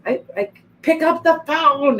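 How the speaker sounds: imitating her own mild yelling at the computer; a hint of a rant at the computer; mock yell. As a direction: replay a frustrated outburst, half-hearted. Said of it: "I was yelling".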